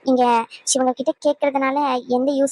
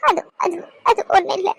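higher, halting tearful denial; tearful stammer; 5 semitones higher than usual. Acted. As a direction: stumble over the words while crying. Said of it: "ஆ, அழுகாதீங்க".

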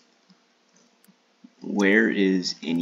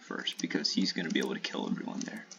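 whisper; 3 semitones lower, 8 dB quieter, faster than usual. Real, not acted.

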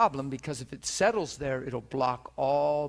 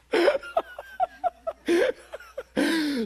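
loud high burst of laughter; big high laugh; 7 dB louder than usual. Real, not acted. Preacher laughs at the devil's attack, loud and high. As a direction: laugh out loud at your own story.

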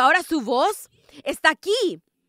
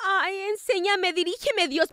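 mock plea; 4 semitones higher, 4 dB quieter than usual. Mocking a believer begging God.

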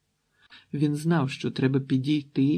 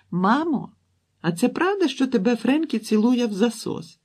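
put-on very high, loud child's voice; little girl asking; 10 semitones higher, 5 dB louder than usual. A narrator's reading. Girl asks mother about the kiss, high childish voice. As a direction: call out to Mom in a piping voice.

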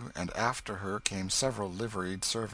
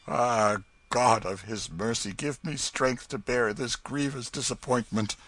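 with emotion; 3 semitones higher, 4 dB louder than usual. A narrator's reading. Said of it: "saying with emotion".

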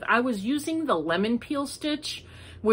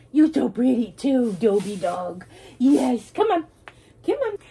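excited pet talk; 3 semitones higher, 5 dB louder than usual. Real, not acted. Calling the dogs.

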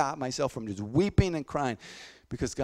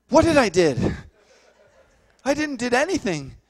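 bewildered; 11 semitones higher, 11 dB louder than usual. Retold or imitated. Boy stunned by the healing.

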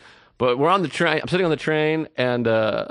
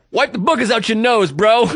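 high, louder schoolyard jab; mock taunt; 6 semitones higher, 4 dB louder than usual. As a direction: taunt him like a playground bully. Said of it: "The Puerto Rican guy finally goes".

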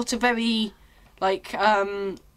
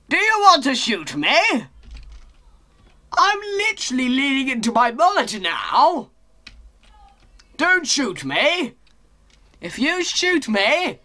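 hyped up; 4 semitones higher, 4 dB louder than usual. Real, not acted. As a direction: stretch each phrase with giddy energy. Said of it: "I don't know why I'm so hyped".

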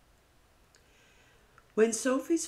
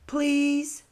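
put-on high, loud whiny little-kid please; pleading child; 11 semitones higher, 7 dB louder than usual. Stephen pleading with Sophie for her picture, high and whiny. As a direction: wheedle like a small boy.

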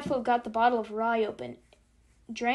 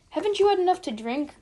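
high, louder reproachful question; scolding; 7 semitones higher, 6 dB louder than usual. A narrator's reading. Clevenger to the drunk Chief, scolding. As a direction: scold the drinker in disbelief. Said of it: "began scolding".

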